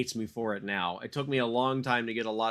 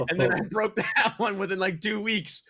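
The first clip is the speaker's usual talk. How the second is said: laughing; 8 semitones higher, 3 dB louder than usual. Real, not acted. Laughing at breaking second tooth.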